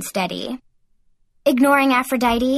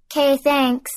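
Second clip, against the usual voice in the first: lame, higher-pitched thanks; 4 semitones higher than usual. A narrator's reading. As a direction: offer thanks half-heartedly. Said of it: "I said lamely".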